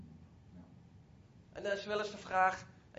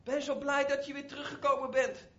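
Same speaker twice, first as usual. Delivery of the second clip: higher, louder happy exclamation; excited, a touch louder; overjoyed father; 6 semitones higher, 4 dB louder than usual. Retold or imitated. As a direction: pour out the joy of having him back. Father embraces returning son.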